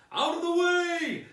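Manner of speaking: Father Christmas bursting free; yelling. A narrator's reading. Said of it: "Father Christmas yelled".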